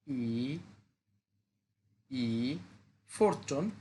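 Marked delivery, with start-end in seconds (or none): 0.1-2.6 ই dipping low and broken; Mandarin third tone. Shown as a demonstration. Teacher shows the third tone on ই, low dip. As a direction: sink ই low then lift. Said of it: "third tone ভেঙে বলতে হয়".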